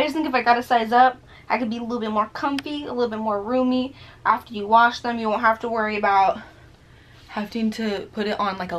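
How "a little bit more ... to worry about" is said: normal talking voice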